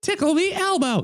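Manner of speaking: high, quick puppet voice; Elmo voice. Pretend. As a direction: squeak it like a small red puppet. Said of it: "He sound more like that".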